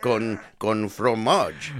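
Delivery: joking to a co-host in a mock French accent. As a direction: drawl it out in a silly fake French accent. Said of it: "I gotta say it in French too".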